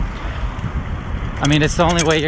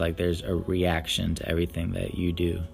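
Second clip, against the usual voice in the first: subdued reflective; 7 semitones lower, 9 dB quieter than usual. Real, not acted. Reflective soft monologue.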